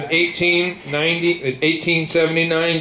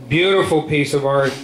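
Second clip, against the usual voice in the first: ordinary talk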